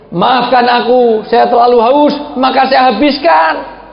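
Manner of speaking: high, drawn-out apology; loud acted apology. Husband apologising to his wife for drinking it all, calling out. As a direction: call it out high and slowly.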